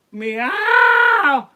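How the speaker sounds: very high, long, screaming meow; hammed-up wail of a weeping cat; crying cat meow. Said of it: "more cry".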